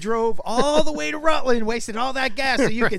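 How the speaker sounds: loud, high, ranting disbelief; exasperated shout. Real, not acted. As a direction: yell out how absurd it was. Exasperated rant at wife.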